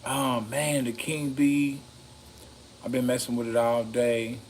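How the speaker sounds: normal talking voice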